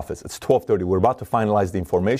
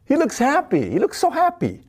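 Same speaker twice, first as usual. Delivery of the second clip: much higher, excited gush about a happy face; delighted inner voice; 10 semitones higher than usual. Pretend. Reading a stranger's eyes, gushing in a high delighted voice. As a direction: gush like you just spotted someone beaming.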